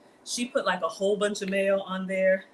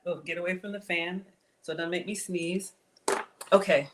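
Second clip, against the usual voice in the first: ordinary delivery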